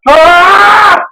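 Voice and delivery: loud, high "Ah!"; mock panic scream. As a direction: scream as if flashbacks hit you. Mock flashbacks, told to calm down.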